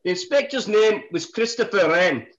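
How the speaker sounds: high, loud put-on Scottish accent. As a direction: present the case in hammed-up Scottish. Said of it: "Do I do it in a Scottish accent".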